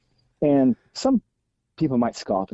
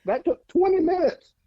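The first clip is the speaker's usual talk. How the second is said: much higher, laughing disbelief; amused disbelief. Real, not acted. Brother teasing about the twenty-minute sock setup. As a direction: tease him, squeaking in disbelief.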